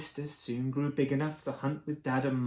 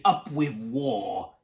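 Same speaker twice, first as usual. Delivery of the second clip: low, slow tyrannosaur war cry; understated shout, each word drawn out; mild mock shout; 5 semitones lower, slower than usual. A narrator's reading. Two tyrannosaurs call for war, mild low shout. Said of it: "And they shouted".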